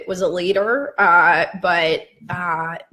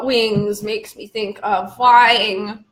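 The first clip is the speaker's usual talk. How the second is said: slow musing; much slower than usual. Real, not acted. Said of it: "slow word-chain monologue".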